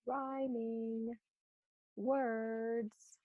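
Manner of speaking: quiet slow dictation; soft dictation. Shown as a demonstration. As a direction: murmur each word while writing. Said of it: "So I'm gonna write that".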